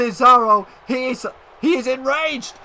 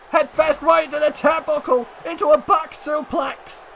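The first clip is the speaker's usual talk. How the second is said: normal talking voice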